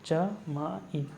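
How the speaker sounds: चमाइन stretched out slowly; teacher dictating the answer slowly; slow, drawn-out. Shown as a demonstration. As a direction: draw out चमाइन syllable by syllable. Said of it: "ठीक है, चमाइन".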